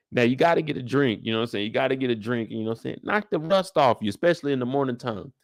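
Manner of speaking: usual speaking voice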